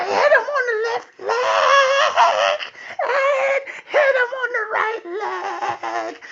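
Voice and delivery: very high, screechy child imitation; frenzied egging on. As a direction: shriek like a child demanding a beatdown. Daughter's mounting frenzy, acted out.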